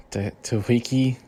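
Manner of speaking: normal talking voice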